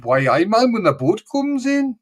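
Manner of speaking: put-on Geordie accent. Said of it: "we can do Newcastle".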